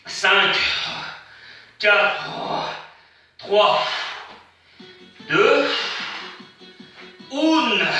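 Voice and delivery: countdown rising to the end; workout rep counting. Real, not acted. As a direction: push them through the last seconds. Coach counts down.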